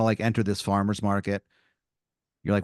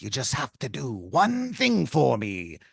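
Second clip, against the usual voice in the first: lightly voiced pirate making a deal; pirate tomato. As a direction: lay down a pirate's bargain. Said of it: "He goes".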